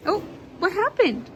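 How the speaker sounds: ordinary delivery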